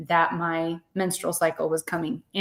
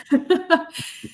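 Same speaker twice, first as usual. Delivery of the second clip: rueful laugh; 9 semitones higher than usual. Real, not acted. Laughing at her own minivan surrender to the listener, short rueful laugh. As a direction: laugh it off at yourself.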